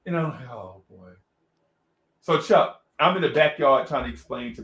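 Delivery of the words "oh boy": very low, hushed aside; resigned dismay. Retold or imitated. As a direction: sink into a quiet mutter of defeat.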